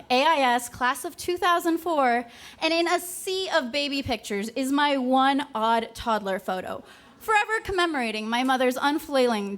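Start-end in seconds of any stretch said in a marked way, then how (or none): none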